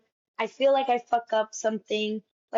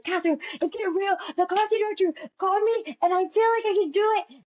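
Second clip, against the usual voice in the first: much higher, quick and giddy; giddy excitement; 8 semitones higher, faster than usual. Retold or imitated. Telling her sister about the casting call, giddy and high. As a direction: bubble over like you just got news.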